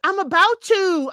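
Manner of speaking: imitating an irritated I'm about to; mock snippy retort. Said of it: "Stephanie's like".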